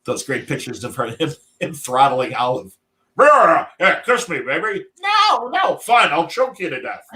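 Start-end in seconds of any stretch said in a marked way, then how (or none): 3.2-4.9 mock Popeye. Voicing Popeye in a drawing. 5.0-7.1 much higher, squeaky Olive Oyl refusing; mock Olive Oyl. Voicing Olive in a drawing.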